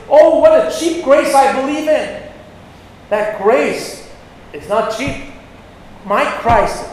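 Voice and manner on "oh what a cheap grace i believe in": higher and louder, a sarcastic sing-song line of false praise; mock hymn. Preacher mocking churchgoers' cheap grace, sarcastic sing-song. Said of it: "what they should be singing is".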